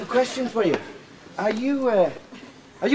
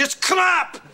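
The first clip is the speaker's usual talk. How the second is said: raised, emphatic catchphrase; exclaimed punchline; 6 semitones higher than usual. Acted. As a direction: punch out the slogan's last word. Shouted shop slogan punchline.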